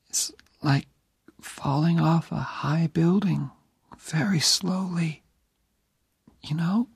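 put-on high, weak whisper of a dying young woman; dying girl's whisper. Dying Jenny asking Oliver to stay near, whispered. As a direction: breathe it out, barely there.

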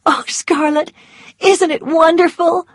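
high, excited gush; overflowing with joy; delighted. A narrator's reading. Melanie gushing to Scarlett, high and excited.

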